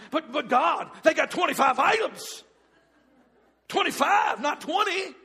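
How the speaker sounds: high, loud shouted complaint; shouted protest to God about twenty-five items; exasperated protest. Acted. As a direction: plead your case loudly to God. Arguing back to God.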